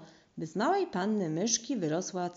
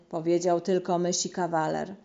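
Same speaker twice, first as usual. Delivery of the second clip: ordinary delivery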